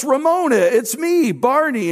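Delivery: high, eager cry of her name; a hopeful Barney calling out to his old crush. Retold or imitated. Barney eagerly greets old flame.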